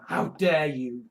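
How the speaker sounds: very low, slow indignation; slow, low-pitched pretend outrage; mock seething. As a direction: draw it out low, as contained mock anger. Acts out seething, voice far lower.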